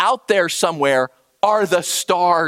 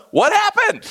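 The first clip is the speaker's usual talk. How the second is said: sharp boss question; 3 dB louder than usual. Retold or imitated. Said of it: "And he says to them".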